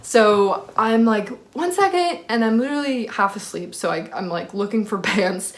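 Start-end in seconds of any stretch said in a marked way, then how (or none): 1.5-2.2 high-pitched sing-out to someone knocking; calling through door. Retold or imitated. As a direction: call out in a much higher voice.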